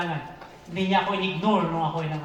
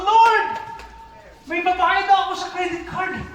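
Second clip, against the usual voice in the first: excited; 11 semitones higher, 6 dB louder than usual. Retold or imitated. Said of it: "Sabi ko".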